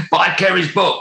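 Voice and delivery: laughing, up high. Real, not acted. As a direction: say it while cracking up. Laughing through repeat plug.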